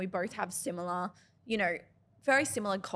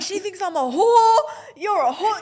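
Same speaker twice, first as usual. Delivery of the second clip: high, loud, laughing half-cry; laughing mock panic; 9 semitones higher, 13 dB louder than usual. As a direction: shriek it through laughter, mock horrified. Retelling panic meeting his mum.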